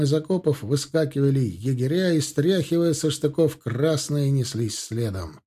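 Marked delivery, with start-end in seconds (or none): none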